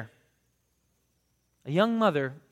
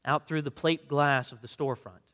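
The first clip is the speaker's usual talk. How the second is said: ordinary talk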